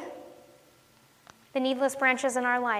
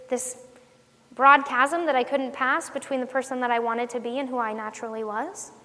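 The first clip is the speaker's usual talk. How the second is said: ordinary talk, nothing marked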